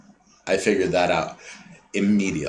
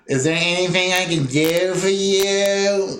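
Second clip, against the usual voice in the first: higher, slow, sarcastic drawl; mock fake politeness; 7 semitones higher than usual. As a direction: drag it out like a bored clerk brushing you off. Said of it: "what they mean is".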